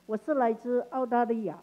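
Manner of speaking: ordinary delivery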